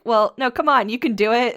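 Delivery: loud, high cheer of encouragement. Retold or imitated. Sophie cheering the exhausted witch up the stairs, loud high cheer.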